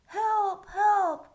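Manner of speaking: high loud cry for help. Acted. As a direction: shout it out like a panicked page. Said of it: "cried the page".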